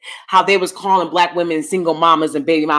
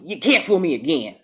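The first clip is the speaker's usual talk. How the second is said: ordinary talk, nothing marked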